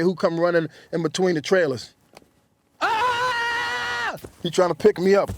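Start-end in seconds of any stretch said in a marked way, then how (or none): 2.8-4.2 very high excited scream of delight; joyful yell. Retold or imitated. Friend running between the trailers, high joyful scream. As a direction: shriek like you just spotted your old friend.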